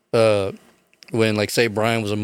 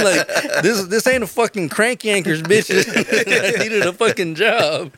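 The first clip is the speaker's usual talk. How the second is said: high, louder laughing rant; mock rant; 11 semitones higher, 3 dB louder than usual. Man ranting at Sean for costing him the job, high laughing rant.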